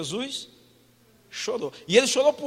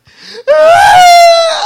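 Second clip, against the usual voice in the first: high, loud wailing cry; hammed-up wailing sob; 20 dB louder than usual. Mourner bursts into a wailing sob at a wake. Acts crying after "não chora.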